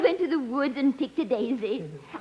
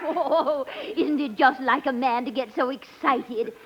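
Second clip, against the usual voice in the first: a hint of a fluttery, giddy society lady; scatterbrained lady; 3 dB louder than usual. Billy gushes to Eddie, lightly voiced giddy lady. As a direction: play it dizzy and delighted. Billie Burke's scatterbrained persona.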